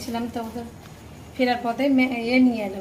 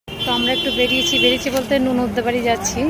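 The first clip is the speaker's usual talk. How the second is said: normal talking voice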